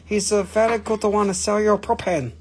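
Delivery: hammed-up Japanese-or-Chinese accent. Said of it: "This is not Japan, this is China".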